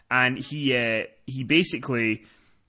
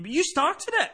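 whiny protest. Retold or imitated. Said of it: "And you".

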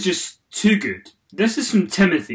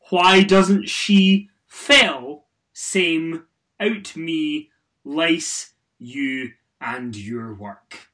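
reading a typo-filled tweet; much slower than usual. Pretend. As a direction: read each word stiffly as misspelled. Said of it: "read it as he has written it".

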